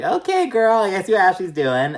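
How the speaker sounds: high, loud, sassy mimicry; sassy mock voice. Vlogger mocking a cast member at dinner, sassy high voice. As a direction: mimic her with sassy attitude.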